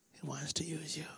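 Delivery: soft, whispered promise. Real, not acted. Preacher whispering God's purpose to the woman. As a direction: lean in and breathe it.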